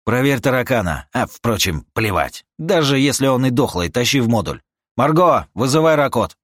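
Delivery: loud, urgent commands; shouted orders. Acted. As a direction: bark the orders out fast. Barking orders in a hurry.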